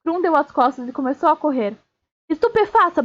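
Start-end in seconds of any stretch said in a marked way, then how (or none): 2.3-3.0 high-pitched spell cry; berro de feitiço. A narrator's reading. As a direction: cast it with a sharp rise in pitch. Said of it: "berrou Harry". (grito agudo de feitiço)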